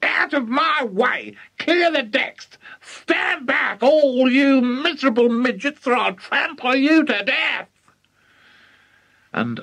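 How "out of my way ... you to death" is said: high-pitched grandma shouting; wild, high-voiced yelling from horseback. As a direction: shout it high, like a rampaging old hag.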